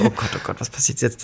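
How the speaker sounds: hushed, worried inner voice; panicked murmur. Retold or imitated. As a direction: whisper it to yourself in panic. Panicking as the guard approaches.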